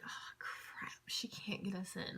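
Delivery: unvoiced whisper of disappointment; dismayed whisper. Retelling her fear that they won't get in, whispered. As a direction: breathe it out as a hopeless whisper. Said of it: "And I'm like".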